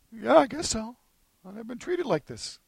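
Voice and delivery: soft, high-pitched tentative acceptance; pampered wife. Acted. Wife to husband offering hot chocolate, softly accepting.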